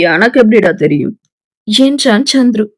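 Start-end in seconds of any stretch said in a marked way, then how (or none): 0.1-1.1 low, quick, offhand friend; Chandru's voice. Acted. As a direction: toss it back like a puzzled buddy.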